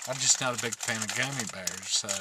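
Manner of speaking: ordinary talk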